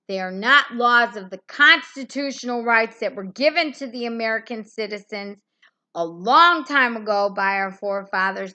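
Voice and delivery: loud, heated tirade that keeps building; real furious yelling at the authorities; angry shouting rant. Real, not acted. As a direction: shout them down with righteous fury. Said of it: "I'm getting angry, mad".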